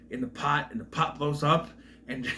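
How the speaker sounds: usual speaking voice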